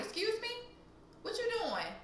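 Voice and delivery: high-pitched startled challenge; taken aback. Retold or imitated. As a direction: question him in a high, incredulous voice. Confronts stranger at car door.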